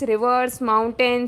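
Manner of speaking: ordinary delivery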